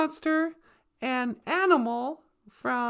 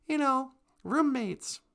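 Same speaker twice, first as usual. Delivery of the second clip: usual speaking voice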